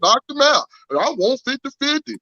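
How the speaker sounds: boastful fighter impression. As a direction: crow about the knockout, way up high. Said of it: "he gonna act like he beat Mike motherfucking Tyson".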